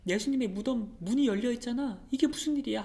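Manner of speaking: alarmed exclamation. Acted. Mary shocked at the open tomb, startled cry. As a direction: call it out as if the tomb is empty before you.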